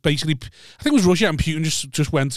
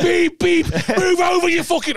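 very high, honking driver yell; mock road rage; 3 dB louder, slower than usual. Fuming driver honking at the scooter ahead, high mock rage. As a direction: play the fuming driver stuck behind. Acts an angry driver behind them.

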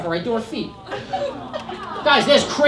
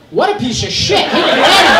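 louder, disgusted mock judgment, rising at the end; mock outraged family; 2 semitones higher, 6 dB louder than usual. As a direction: friends and family sneering at him from home. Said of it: "sitting at home going".

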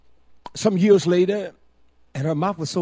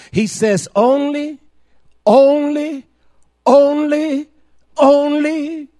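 only repeated, each higher and louder; stress on only; 10 semitones higher, 10 dB louder, much slower than usual. Shown as a demonstration. Preacher to his listeners, pounding only. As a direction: hammer the word only home. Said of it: "the word only is emphasizing".

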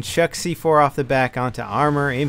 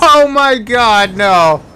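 slow, loud drawn-out cry; surprised; 6 semitones higher, 7 dB louder, much slower than usual. Real, not acted. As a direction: stretch it out in shock. Stretched exclamation.

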